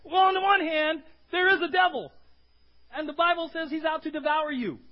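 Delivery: high, loud, pressing sermon voice; fiery preaching. Acted. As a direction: preach it hard to the congregation. Preaching at full force.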